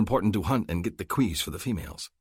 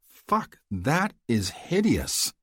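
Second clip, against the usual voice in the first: disgusted shock; 7 semitones higher, much slower than usual. A narrator's reading. Seeing monster.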